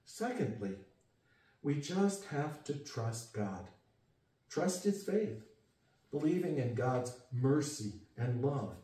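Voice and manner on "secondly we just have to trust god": ordinary talk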